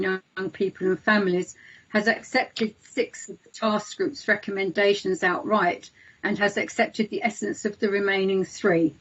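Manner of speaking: ordinary talk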